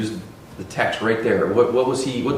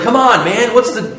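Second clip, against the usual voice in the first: higher and louder "Come on, man"; exasperated plea; 10 semitones higher, 5 dB louder than usual. Retold or imitated. Older son protesting to his father, exasperated. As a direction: throw up your hands, exasperated. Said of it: "It's like".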